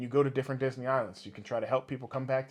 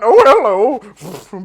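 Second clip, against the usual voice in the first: loud, high, drawn-out cartoon greeting; Merlin; 9 semitones higher, 19 dB louder, much slower than usual. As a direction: stretch out a booming, silly hello. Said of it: "Merlin's trotting around".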